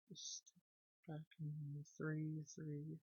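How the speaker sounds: slow, hushed repeat. Real, not acted. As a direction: drag out "back in three three" barely audible.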